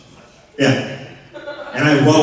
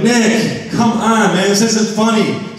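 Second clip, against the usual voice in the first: high, whiny protest; exasperated complaint; 7 semitones higher, slower than usual. Retold or imitated. Calling to roommate Nick again, fed up and whiny. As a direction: plead with him to stop the prank. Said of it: "I'm like".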